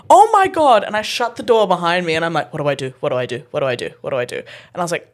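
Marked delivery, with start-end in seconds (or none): 0.1-0.8 high, loud retold shock; shocked exclamation. Retelling her shocked reaction. 2.5-4.4 low, soft repeated fretting; muttered panic. Retold or imitated. Retold panic behind shut door.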